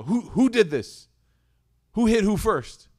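loud, high, slow demand; parent yelling. Retold or imitated. Father demanding to know which kid hit first, loud. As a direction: bark the question at the kids.

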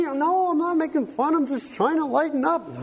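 high-pitched mock protest; defensive husband. Husband excusing his joke to his wife, high and defensive. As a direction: plead innocence in a hammed-up high voice.

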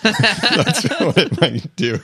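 laughing through the words. Real, not acted. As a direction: say it while laughing hard. Laughing after friend's joke.

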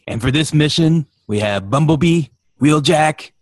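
loud, heroic mission briefing; announcer voice. Pretend. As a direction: announce the team like a cartoon commander. Announcing Autobot mission lineup.

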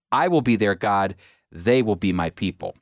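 normal talking voice

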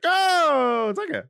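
high-pitched breezy mock voice. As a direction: toss it off in a squeaky voice. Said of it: "It was like".

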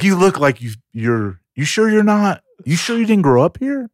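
imitating insistent disbelief; doubting locals. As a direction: pitch it up like a skeptical local. Locals doubting his origins, retold.

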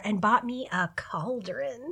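slow, hushed whisper; slow conspiratorial whisper; whispered secret. Acted. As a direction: let them in on a secret. Confiding a fun purchase.

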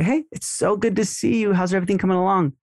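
friendly greeting. Shown as a demonstration. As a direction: greet them like an old friend. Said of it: "You're gonna say".

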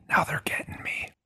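unvoiced whisper. Real, not acted. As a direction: breathe it out in a whisper. Joke whisper after choking.

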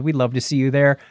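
normal talking voice

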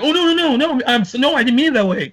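high, flustered, tripping over words; stumbling denial. Retold or imitated. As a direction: imitate him stumbling over his words. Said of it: "stumbling over his words".

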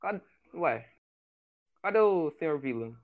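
talking around a cigar; words through a cigar clenched in teeth; cigar in mouth. Acted. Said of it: "eu tô com o charuto na boca".

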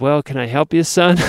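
lightly put-on Southern accent. Gas station fellow offers help, imitated Southern accent. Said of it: "In this wonderful Southern accent".

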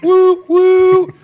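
high, loud woo woo train whistle. Pretend. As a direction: toot like a toy train whistle. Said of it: "you and your train track noises".

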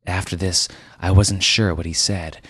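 usual speaking voice

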